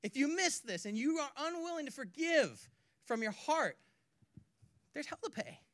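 hint of a solemn prophet; mild Jesus warning. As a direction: press each word like a grave warning. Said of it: "Jesus literally says".

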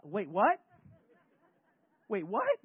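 loud double take swooping up at the end; acted shocked king; indignant disbelief. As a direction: stop short, then a rising WHAT. Saul hears David praised above him.

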